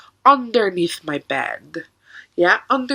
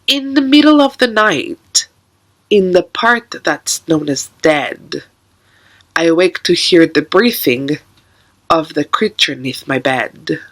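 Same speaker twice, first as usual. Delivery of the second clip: poem recitation; 4 semitones lower, 4 dB louder than usual. Acted. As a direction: recite it darkly, pausing after each line.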